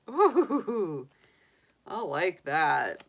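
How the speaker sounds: usual speaking voice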